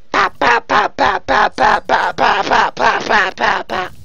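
rapid, evenly popped gun noises; imitated gunfire. Gamer mocking spray and pray, imitated gunfire. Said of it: "All you can do is spray and pray".

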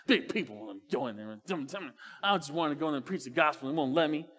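low, sulky mock grumble under his breath; grumbling. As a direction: mutter it like a sulking kid in a basket. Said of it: "he was grumbling the entire time".

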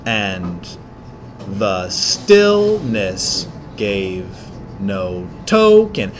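slow, low poetry reading; poem reading. Acted. Teacher reading poem to the class, slow and low. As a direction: read the verse slowly and gravely. Reads poem lines slowly.

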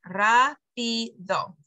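"rápido" split slowly into three syllables, RÁ stressed; slow, separated "rápido", leaning on RÁ. Shown as a demonstration. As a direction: spell out RÁ, pi, do by ear. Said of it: "there's three syllables there".

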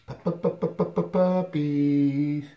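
high, slow sing-song call to the puppies. Real, not acted. Owner calling the puppies over, high sing-song coax. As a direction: stretch it out high, coaxing pups over.

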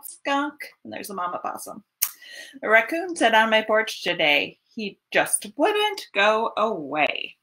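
plain reading voice

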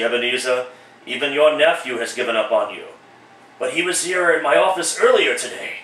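ordinary delivery

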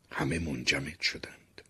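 plain reading voice

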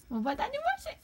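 high teasing pet voice; squeaky teasing baby voice; baby talk teasing. Acted. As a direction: playfully threaten the bird. Teasing the bird.

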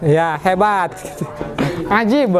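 ordinary delivery

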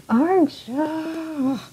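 high, loud drawn-out yell; weird hoarse scream. Acted. Noelle screams at Archer with a cold, weird hoarse yell. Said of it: "Noelle's scream sounds very weird and hoarse".

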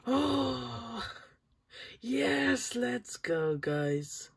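high, loud cheer; real joy, loud and high. Real, not acted. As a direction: cheer out the win. Celebrates the rare pull.

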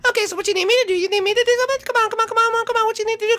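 very high, loud, manic squeal; ecstatic Lemmy. Acted. Lemmy greets his cousin in a high manic squeal. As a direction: bounce off the walls with giddy glee.

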